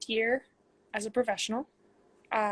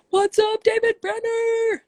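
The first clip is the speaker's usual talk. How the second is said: high, loud and drawn-out hello; a big excited hey to a friend who joined the live; excited greeting; 12 semitones higher, 8 dB louder, slower than usual. Real, not acted.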